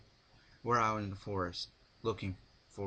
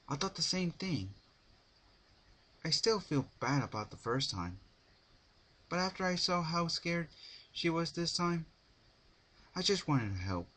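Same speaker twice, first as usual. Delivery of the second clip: put-on higher, worried young mare; regretful Twilight; 8 semitones higher than usual. A narrator's reading. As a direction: pitch up, a touch of regret.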